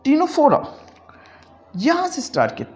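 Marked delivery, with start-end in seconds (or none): 0.0-0.6 Ctenophora said as tinofora, c silent. Shown as a demonstration. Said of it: "हम सी को खामोश रखेंगे". (Ctenophora में सी खामोश)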